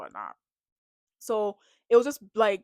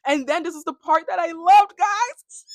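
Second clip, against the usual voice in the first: loud, high burst of excitement; excited; 8 semitones higher, 10 dB louder than usual. Real, not acted. Vlogger hyping up her favorite moment to viewers, loud and high. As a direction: gush to your viewers about the best part.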